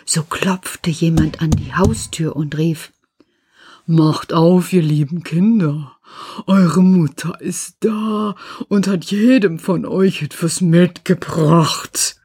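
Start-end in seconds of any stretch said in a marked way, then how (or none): 3.9-12.2 slow, rough voice of the wolf. Acted. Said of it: "hörten an der rauen Stimme". (raue Wolfsstimme)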